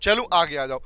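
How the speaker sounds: normal talking voice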